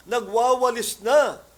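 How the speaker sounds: high, loud and slow; excited announcement. Retold or imitated. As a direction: blurt out the news. Sister bursting with news.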